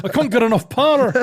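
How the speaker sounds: put-on the starship engineer in a panic, Scottish accent; Star Trek Scottish engineer. Quoting the Star Trek engineer, laughing Scottish accent. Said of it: "it was Star Trek derived".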